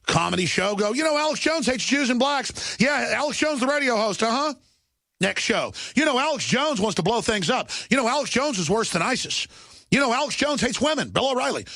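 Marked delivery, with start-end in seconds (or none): 0.9-4.5 high sneering pundit voice; mocking TV pundit. Mocking TV pundits' smear chatter. 6.0-11.6 high sneering pundit voice; mocking TV pundit. Mocking TV pundits' smear chatter.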